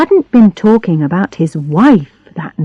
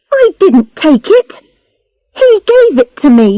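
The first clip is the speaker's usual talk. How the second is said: defensive; 9 semitones higher, 4 dB louder than usual. A narrator's reading. Defensive denial.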